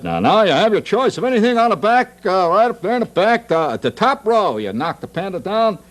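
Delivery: high, pushy barker's call; carnival booth guy. Acted. Booth man offering the panda shooter his prize, high carny patter. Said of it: "And the guy said".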